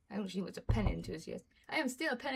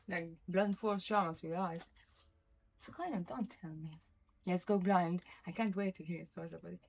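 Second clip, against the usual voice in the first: ordinary talk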